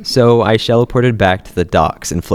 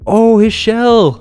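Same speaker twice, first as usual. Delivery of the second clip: high, slow awed cry; amazed; 12 semitones higher, 4 dB louder, much slower than usual. Real, not acted. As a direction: gasp at the find.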